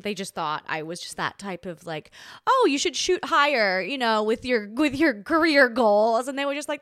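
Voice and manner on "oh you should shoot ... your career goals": patronizing. Retold or imitated. Mocking patronizing advice to ex.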